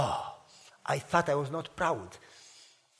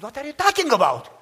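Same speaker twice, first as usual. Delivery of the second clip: indignant protest; 7 semitones higher, 10 dB louder than usual. Retold or imitated. Son protests father's rebuke.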